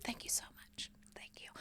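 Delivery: breathless whispered thanks; little diva thanking her fans; gracious whisper. Retold or imitated. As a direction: whisper like a humble star.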